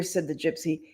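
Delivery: plain reading voice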